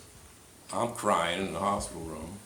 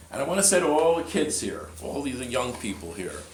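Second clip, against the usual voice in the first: preaching; 4 semitones higher, 5 dB louder than usual. Acted. Preacher addresses the young people.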